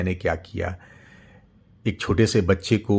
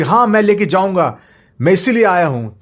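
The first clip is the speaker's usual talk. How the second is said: defiant father; 10 semitones higher, 7 dB louder than usual. Acted. Father answers.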